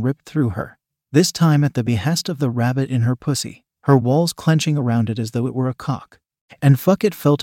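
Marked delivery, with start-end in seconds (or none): none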